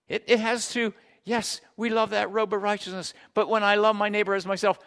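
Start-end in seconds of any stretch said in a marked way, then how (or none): none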